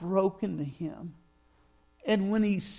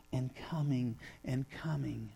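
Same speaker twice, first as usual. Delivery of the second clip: whisper; 5 semitones lower, 6 dB quieter than usual. A narrator's reading. Trailing whisper.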